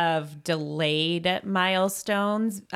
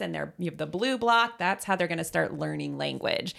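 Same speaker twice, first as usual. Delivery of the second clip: ordinary talk, nothing marked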